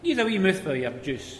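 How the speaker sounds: bossy pit manager demanding; a bossy manager put on; gruff boss voice.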